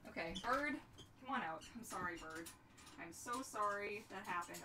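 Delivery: normal talking voice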